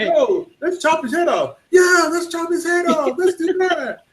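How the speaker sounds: hammed-up bloodthirsty crowd; mob shouting. Mock movie mob.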